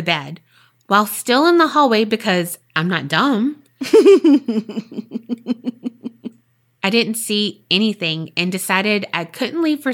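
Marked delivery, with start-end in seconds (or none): 3.8-6.3 a loud burst of real laughter. Real, not acted. Host laughing at her own aside, loud burst of laughter. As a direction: crack up at her own joke.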